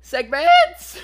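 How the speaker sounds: high, loud, squealing cry; scared peasant. Pretend. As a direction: shriek it like a terrified peasant. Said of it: "you sound like the scared peasant".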